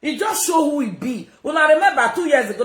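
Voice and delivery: high, loud, fast angry rant; angry shout. Real, not acted. Streamer shouting about the man who posted her photo, angry shout. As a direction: shout it at the rival.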